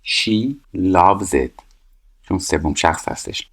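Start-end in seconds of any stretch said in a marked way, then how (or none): 0.0-1.6 very slow and drawn out; slow teacher reading the line; slow read-aloud. Shown as a demonstration. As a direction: read each word out slowly for the class. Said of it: "reading the exercise aloud".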